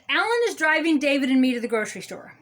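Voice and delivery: quick, run-together example sentence; brisk correct version. Shown as a demonstration. Grammar scold giving the proper sentence, brisk and unbroken. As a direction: rattle the sentence off without pauses.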